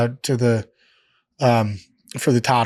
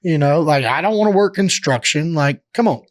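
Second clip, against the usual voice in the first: pleading; 5 semitones higher than usual. Retold or imitated. Retelling his own pleading with Vince.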